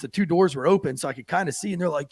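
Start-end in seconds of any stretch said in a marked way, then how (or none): none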